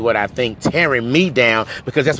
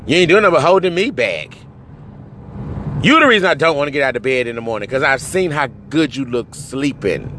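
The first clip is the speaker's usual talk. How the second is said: normal talking voice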